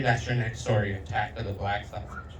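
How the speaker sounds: normal talking voice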